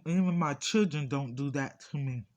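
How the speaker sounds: ordinary talk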